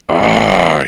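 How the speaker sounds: loud, drawn-out growled "ah". Acted. Game master voicing Nanat over the creature, growling. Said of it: "he growls".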